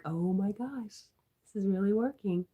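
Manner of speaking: slow, rising amazement; amazed. Retold or imitated. Hypnotist recalls a session working, amazed. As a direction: marvel as it works. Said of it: "You're like".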